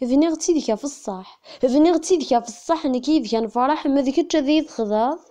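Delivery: measured poetry reading in short phrases; poem recitation. Acted. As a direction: recite verse line by line, letting the last lines sink. (taɣuri n usefru)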